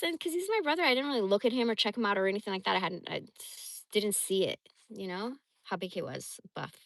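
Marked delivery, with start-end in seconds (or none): none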